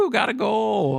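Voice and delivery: high, whooping self-celebration; mock cheer. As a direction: cheer the goal like a kid. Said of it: "And I was like".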